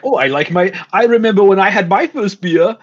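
German accent. Shown as a demonstration. Voice actor shows an acceptable example, German accent. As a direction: chat in a casual German accent. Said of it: "I'm not gonna do a German accent".

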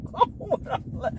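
soft, voiceless words through laughter; laughing protest. Real, not acted.

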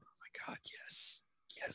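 breathless unvoiced whisper; excited whisper. Real, not acted. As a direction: gasp it out in a whisper. Excited at odd-couple idea.

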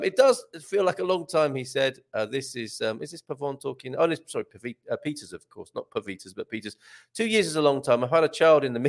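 ordinary talk, nothing marked

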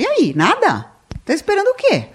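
high, prying mock question; nosy acquaintance. Nosy people pressing the couple about a baby, high prying jab. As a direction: pester like a nosy relative. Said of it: "E falam".

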